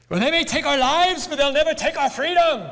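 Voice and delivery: a clumsy Scottish hero's rallying cry; bad Scottish Braveheart impression. Preacher playing a Scottish rebel to the congregation, bad Scottish accent. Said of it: "That was awful".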